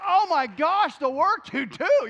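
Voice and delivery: high, loud, drawn out admiration; impressed gush. Retold or imitated. Praising the man at the church door, loud and high. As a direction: gush over his work.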